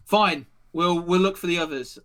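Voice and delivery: loud, brisk acted reply; soldier giving in. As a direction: concede curtly as a soldier. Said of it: "he's like".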